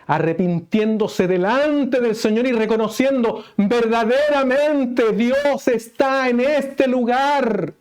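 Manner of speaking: high, loud, impassioned preaching; preaching at full force. Acted. Preacher driving home the mark of a true church, full force. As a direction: proclaim it to the whole congregation.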